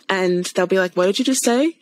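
ordinary delivery

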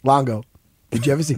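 usual speaking voice

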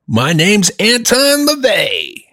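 high, drawn-out grand self-introduction; hammed-up, high-pitched showy proclamation; Anton LaVey. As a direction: announce yourself slowly with a soaring flourish. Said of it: "that guy was like".

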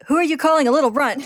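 furious little brother snapping; outraged outburst. Acted. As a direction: blow up at the word runt. Ed cuts off insult, outraged.

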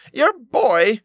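high, loud outraged cry; shouted. A narrator's reading. Sexton's wife shouts at the father, high and loud. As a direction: burst in shouting the news. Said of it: "she shouted".

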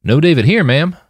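put-on a polite boy soldier answering; guard replying. A narrator's reading. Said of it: "the martyr replied".

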